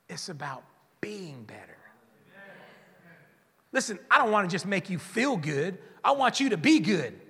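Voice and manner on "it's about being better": hushed, breathy whisper of the key point. Real, not acted. Preacher whispers the point to his congregation. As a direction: lean in and whisper it.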